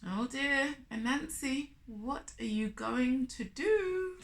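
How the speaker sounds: ordinary delivery, nothing marked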